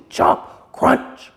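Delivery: usual speaking voice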